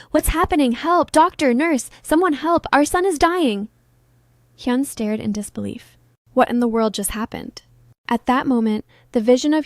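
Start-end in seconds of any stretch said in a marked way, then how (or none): 0.1-3.7 higher, louder panicked cries for help; shrieking in terror. Acted. Parents shriek as their son collapses, panicked. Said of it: "His parents shrieked in terror".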